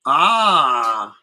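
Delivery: slow, drawn-out villain voice; slow sinister prince. Acted. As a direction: savour each word like a menacing noble. Dracula voiced in the story.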